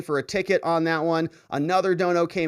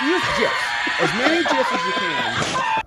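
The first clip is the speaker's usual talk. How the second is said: laughing; 9 semitones higher than usual. Real, not acted. Rereading comment, laughing again.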